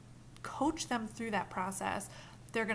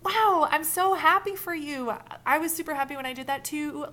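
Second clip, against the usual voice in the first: matching excitement; 6 semitones higher, 9 dB louder than usual. Shown as a demonstration. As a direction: share their thrill fully. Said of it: "But if you were like".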